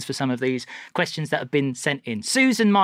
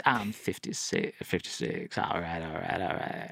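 low, soft, husky creep, much quieter; Mister Twenty-five impression; 12 semitones lower, 9 dB quieter, slower than usual. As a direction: drop into a low, breathy, sleazy mumble. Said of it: "can I do an impression of the Mister Twenty-five".

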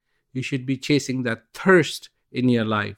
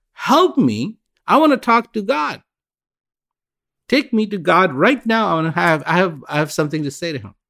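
furious demand; 6 semitones higher than usual. Acted. Drowned woman demands Saint Peter take her to God, furious and higher. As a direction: demand to see God right now. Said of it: "she is furious".

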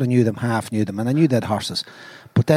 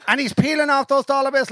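normal talking voice

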